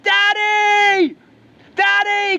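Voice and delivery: high, loud, desperate cry of "Daddy!"; screaming out. Retold or imitated. Lost prince screams to his father the king, desperate high shout. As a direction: scream for your father from the deepest part of you. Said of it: "he screams out".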